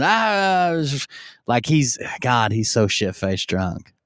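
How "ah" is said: put-on high drawn-out drunken "ah"; mock a drunk's wordless shout, pitched high; imitated drunken shout. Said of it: "mumbles and then he shouts something".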